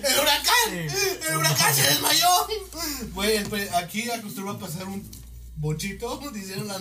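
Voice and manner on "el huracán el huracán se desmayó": high, loud announcer shout. Acted. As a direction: shout it like breaking news. Repeating the shouted headline.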